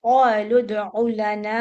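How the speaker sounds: slow, loud tajweed recitation of क़ालू with qalqala on दाल; Quran recitation. Shown as a demonstration. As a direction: recite "क़ालू उदउ लना" slowly, bouncing the दाल. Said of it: "अलिफ के साथ".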